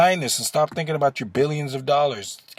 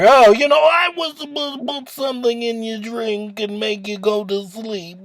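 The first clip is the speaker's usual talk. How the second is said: much higher, loud goofy Cosby impression; mock Cosby voice; 9 semitones higher, 4 dB louder than usual. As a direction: play the confession in a cartoonish Cosby voice. Mocking Cosby's confession in his voice.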